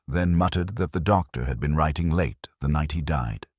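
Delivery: ordinary talk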